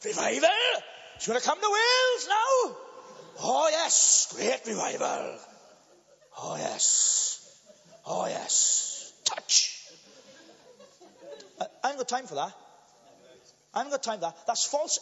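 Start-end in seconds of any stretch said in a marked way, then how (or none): 0.0-9.9 a lightly hammed-up revivalist crying out; mock revival hype, shouted then breathy; mock hype preacher. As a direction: parody an overexcited revival preacher, fading to breathy. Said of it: "some people create this hype".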